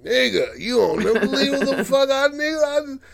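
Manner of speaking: high-pitched comic sputtering; worked-up disbelief. Acted. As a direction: gush in mock disbelief, voice shooting way up. She exclaims to brother, disbelieving.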